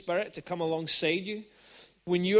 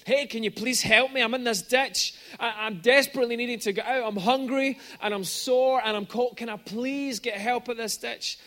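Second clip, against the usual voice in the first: higher, louder storyteller's plea; imitating a stranded man's pleading shout; acted shout for help; 5 semitones higher, 6 dB louder than usual. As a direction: call up for help, lightly acted. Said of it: "he's shouting for help".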